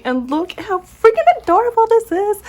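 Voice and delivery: high, loud squeal of delight; really excited, pitch shooting up; gushing excitement. Real, not acted. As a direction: gush over something adorably cute. Gushing over a cute journal.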